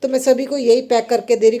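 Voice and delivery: ordinary delivery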